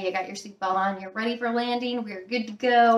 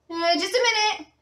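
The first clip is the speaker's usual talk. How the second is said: put-on muffled girl's voice; 9 semitones higher, faster than usual. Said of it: "I hear".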